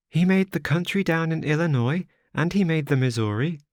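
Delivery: put-on high, childlike girl's voice; little girl. A narrator's reading. Little girl asks the wanderer if they will die, high childlike voice. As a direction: play a small frightened child asking.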